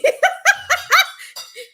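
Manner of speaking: shrieking laugh; high squealing cackle. Real, not acted. Laugh heard.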